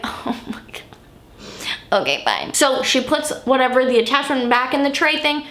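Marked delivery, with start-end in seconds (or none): 0.0-2.5 a defeated little whisper to herself; whispered oh my god, giving in; exasperated whisper. Real, not acted. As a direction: breathe it out unvoiced, much lower at the end. Reacting to redoing the light.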